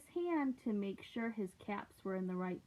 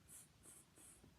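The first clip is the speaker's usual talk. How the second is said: tsk tsk; 31 dB quieter than usual. Acted. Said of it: "shook their fingers back at him and said".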